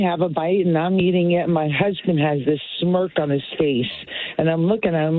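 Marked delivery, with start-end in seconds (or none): none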